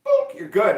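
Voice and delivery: high, loud boop as the system; punchy imitated scanner approval; mock machine boop. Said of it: "it just goes in the system, goes".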